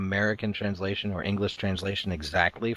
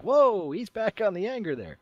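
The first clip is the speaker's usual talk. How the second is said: mock alarm; 13 semitones higher than usual. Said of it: "You're like".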